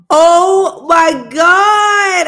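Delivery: loud, slow shocked yell; excited shriek. Real, not acted. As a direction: gasp it out in disbelief. Reacting to fight footage.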